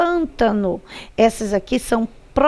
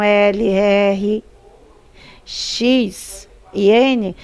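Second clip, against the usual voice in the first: slow dictation; 3 semitones lower, much slower than usual. Shown as a demonstration. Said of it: "você vai pintar todas".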